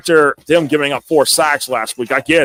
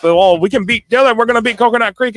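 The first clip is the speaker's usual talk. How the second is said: fired-up team quote; 6 semitones higher, 3 dB louder than usual. Retold or imitated. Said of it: "They're fired up".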